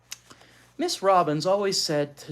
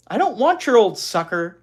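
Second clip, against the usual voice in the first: put-on higher, louder indignant girl; 8 semitones higher, 11 dB louder than usual. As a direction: snap back at the boy. Beezus retorts to Wayne.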